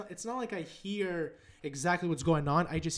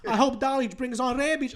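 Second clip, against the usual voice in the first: imitating dad; 7 semitones higher, 3 dB louder than usual. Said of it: "He's like".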